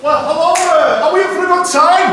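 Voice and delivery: big, high, loud compere call to the audience; crowd-hyping shout. Acted. Comic greeting the crowd with a big, high, loud hype call. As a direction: whip the crowd up with a booming hello.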